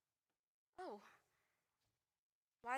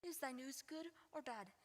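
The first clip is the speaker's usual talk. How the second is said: quiet pleading; 10 dB quieter than usual. Acted. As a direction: ask it softly, almost to herself. Juliet asks twice, pressing.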